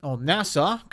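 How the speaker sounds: usual speaking voice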